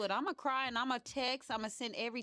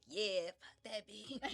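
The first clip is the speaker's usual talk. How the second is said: slow, laughing brush-off; dismissive; slower than usual. Retold or imitated. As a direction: wave it off with a laugh. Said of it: "you like".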